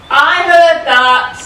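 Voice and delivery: slow booming call from the wings; loud offstage retort. Acted. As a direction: bellow it from offstage. Little John shouts from offstage.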